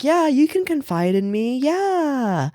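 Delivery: high, syrupy fake-friendly voice; mock sweet false friend; fake sympathy. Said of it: "who are just like".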